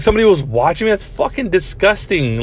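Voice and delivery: high-pitched disgusted disbelief; mock disgust. Imagining someone watched him on his laptop, mock disgust.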